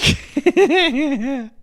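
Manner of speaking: loud, high real laugh; high burst of laughter. Real, not acted. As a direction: crack up out loud. Laughing at a comment.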